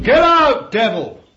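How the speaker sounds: explosive high shout at the devil; very loud, high rebuke; shouted command. Shown as a demonstration. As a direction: yell it as a fierce order. Shows how to treat the devil.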